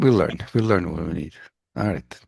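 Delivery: ordinary talk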